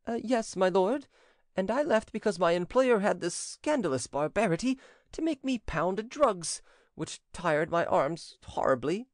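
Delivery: high-pitched aggrieved servant voice; whining servant. A narrator's reading. Servant explaining to the prince why he quit, aggrieved. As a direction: complain high, slowing on the hardships.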